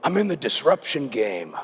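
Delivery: low, smooth bar pickup voice; put-on suave low brag; cool-guy voice. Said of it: "and say it in that voice".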